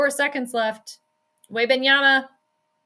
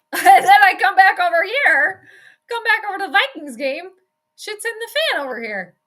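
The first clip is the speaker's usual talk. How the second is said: agitated, exasperated; 7 semitones higher, 6 dB louder than usual. Real, not acted. Switching back to the Vikings game.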